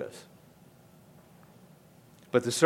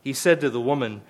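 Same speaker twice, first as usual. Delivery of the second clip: usual speaking voice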